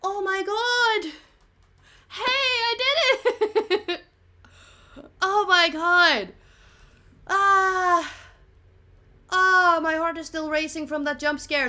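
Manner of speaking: high, loud, slow shaken cries; shaky with adrenaline after a scare; adrenaline shakes. Real, not acted. Racing heart after jump scare.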